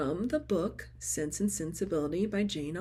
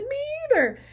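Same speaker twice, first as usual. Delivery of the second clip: much higher, louder squeaky answer; giddy reply; 9 dB louder, much faster than usual. Retold or imitated. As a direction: admit it with giddy glee. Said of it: "I said".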